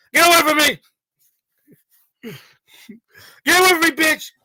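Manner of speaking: loud, high, slow yelling at the cop; mock shout. Co-host acting out shoving off a female cop, loud yell. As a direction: bellow it out, stretched.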